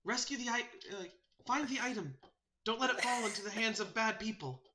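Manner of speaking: high, earnest bird voice; solemn sparrow messenger. Acted. Sparrow urging the last druid to take heart, solemn. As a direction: pass on a grave charge in a high voice.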